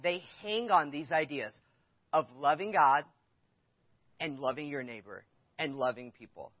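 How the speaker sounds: normal talking voice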